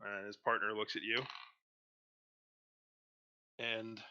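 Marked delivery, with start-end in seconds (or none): none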